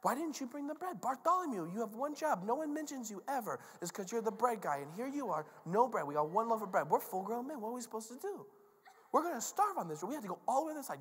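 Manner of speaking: high-pitched, whiny mock squabbling; comic panicky complaining; bickering disciples. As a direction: pile petty blame on the bread guy. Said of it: "stupid disciples are in the boat like".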